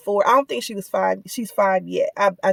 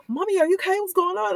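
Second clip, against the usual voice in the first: put-on higher, softer child's voice; worried little daughter; 8 semitones higher, 4 dB quieter, faster than usual. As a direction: mom voicing a scared little girl. Mom voicing her worried little daughter.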